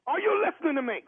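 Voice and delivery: high-pitched, insistent challenge. Real, not acted. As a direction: raise your voice to demand attention. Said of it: "who you keep raising your voice at like that".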